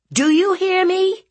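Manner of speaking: very high, loud rising shout; furious aunt. Acted. Aunt demanding nephew obey, shouting angrily. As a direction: yell the question for an answer. Aunt repeats, more forceful.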